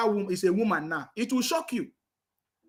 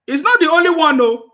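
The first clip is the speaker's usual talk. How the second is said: loud, high stress on not; not the only one, called out loud; emphatic loud declaration; 5 semitones higher, 10 dB louder than usual. Real, not acted. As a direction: push it out with force. Loudly announces more examples.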